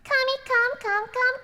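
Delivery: high echoing calling; reverb voice. Shown as a demonstration. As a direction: call out through the reverb. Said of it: "Can you hear it?".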